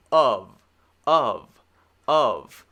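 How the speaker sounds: "Of" said "uhv", loud and falling; separate, punched "Of", ending in V; V sound. Shown as a demonstration. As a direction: drill "Of" with a voiced V each time. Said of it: "Of is actually the V sound".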